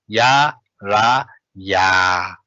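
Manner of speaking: ya, ra, ya spelled out syllable by syllable; own pronunciation. Shown as a demonstration. Teacher shows her own way to say it. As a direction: spell it out slowly, ya, ra, ya. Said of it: "but I produce".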